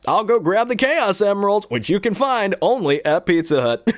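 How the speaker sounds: high, upbeat Sonic voice. Retold or imitated. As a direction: pitch it like a peppy ad. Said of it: "He'll make a joke where he goes".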